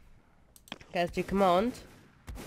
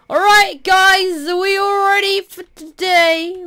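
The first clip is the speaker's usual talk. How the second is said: loud, high call to the troops; rally them with a big, high-pitched yell; rallying shout; 8 semitones higher, 7 dB louder than usual. Pretend. Player to his warriors before battle, rallying shout.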